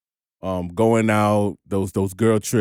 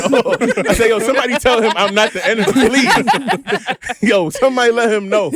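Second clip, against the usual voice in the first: high laughing plea; 12 semitones higher, 4 dB louder than usual. Retold or imitated. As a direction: plead through laughter.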